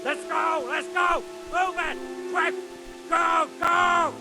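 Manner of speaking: high, loud shouts of command; urgent shouted orders. Acted. Soldier urging the others to move, shouting urgently. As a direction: yell short bursts to get everyone moving.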